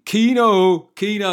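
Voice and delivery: loud, high hail for a mate; rowdy cheer. Pretend. Host pretends his mate James walks in, rowdy cheering shout. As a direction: holler the nickname like a lads' greeting. Greeting a mate arriving in the room.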